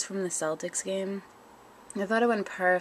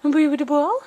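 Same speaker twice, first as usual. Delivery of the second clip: coaxing pet voice; 7 semitones higher, 9 dB louder, faster than usual. Real, not acted. Urging the cat.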